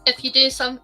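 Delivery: ordinary talk, nothing marked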